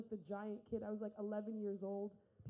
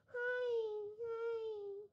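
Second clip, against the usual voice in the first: put-on much higher, chirpy teenage-girl voice; mock a woman acting fifteen; girly customer; 10 semitones higher, slower than usual. Middle-aged woman acting fifteen ordering.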